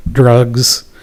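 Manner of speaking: usual speaking voice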